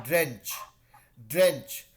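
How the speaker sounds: ड्रेंच said clearly like drain plus bench; pronunciation demo. Shown as a demonstration. Teacher models ड्रेंच for students, clear and firm. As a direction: model the word ड्रेंच for the class. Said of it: "अभी सुनिए इसका pronunciation कैसे होता है".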